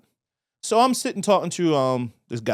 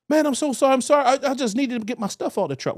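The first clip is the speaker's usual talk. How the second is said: higher, put-on sorry voice; mock apology; 9 semitones higher than usual. The guy apologizing to Darnell, higher mock apologetic voice. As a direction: imitate him groveling up high. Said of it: "he looked".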